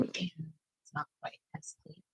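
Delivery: low confiding whisper; whispered aside. Real, not acted. Admitting her desk is messy, in a whisper.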